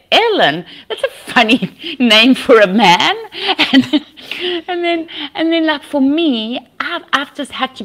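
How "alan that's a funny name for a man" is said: Australian reaction. Retold or imitated. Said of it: "they say".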